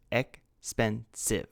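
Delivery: "expensive" drawn out slowly, each of its three syllables separate. Shown as a demonstration. Teacher counting syllables for students, spoken slowly. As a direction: stretch "expensive" so all three syllables are heard. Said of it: "three syllables".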